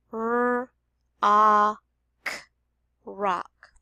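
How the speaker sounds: "R, A, K" apart then "rock"; sounding out then blending. Shown as a demonstration. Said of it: "work together to make one sound".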